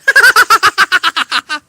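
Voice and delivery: high loud maniacal cackle; mad scientist laugh. Acted. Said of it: "laugh like a mad scientist".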